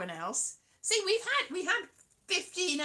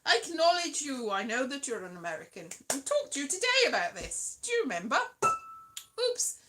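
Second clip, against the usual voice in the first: usual speaking voice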